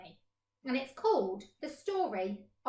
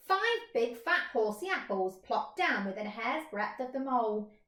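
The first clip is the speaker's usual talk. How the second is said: ordinary delivery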